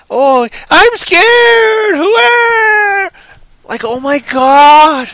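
high, slow, drawn-out wail of fake terror; mock scared scream. Reviewer mocks the overacted film heroine, wailing in hammed-up fear. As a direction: stretch it out loud like a terrible actress. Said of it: "All she does is scream".

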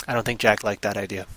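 usual speaking voice

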